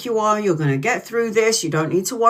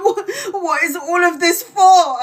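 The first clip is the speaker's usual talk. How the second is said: high, slow, wailing mock sob; imitating crying mum; 10 semitones higher, 4 dB louder than usual. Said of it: "crying her eyes out".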